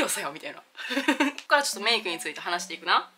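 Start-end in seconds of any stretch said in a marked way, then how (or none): 0.7-1.4 short laugh. Real, not acted. Laughing at own story.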